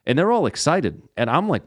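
ordinary talk, nothing marked

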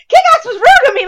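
"Kick-Ass was rude to me" high and loud through laughter; "Kick-Ass was rude to me" cried out in laughing disbelief; laughing outburst. Retold or imitated. Retold outburst on realising.

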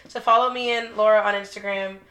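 ordinary delivery

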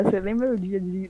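usual speaking voice

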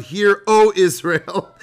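high, loud, stretched-out declaiming; mock-grand proclamation. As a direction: proclaim it pompously, drawing out each word. Mock-grand recital of translation, then laughs.

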